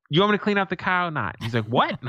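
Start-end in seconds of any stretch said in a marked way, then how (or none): 0.1-1.4 higher, quick retort; mock exasperated comeback, rushed and raised. Grandson answering grandfather about cleaning the car, exasperated retort. 1.7-2.0 high, rising challenge; challenging. Retold or imitated. Grandfather firing back at his grandson, challenging.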